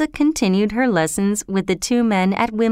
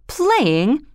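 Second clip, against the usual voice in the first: mildly indignant, higher 'Playing?'; 6 semitones higher than usual. A narrator's reading. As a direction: throw the word back, offended. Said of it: "replied Mister Higgins angrily".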